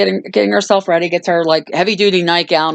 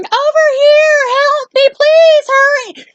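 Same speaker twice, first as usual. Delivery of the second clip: very high, slow mock plea; voice calling for help; 5 dB louder than usual. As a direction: stretch it out in a little high, loud voice. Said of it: "the voice starts going".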